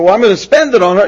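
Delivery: put-on loud, fast, eager kid; hammed-up eager youngster; eager teen. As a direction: rush it out like an excited boy.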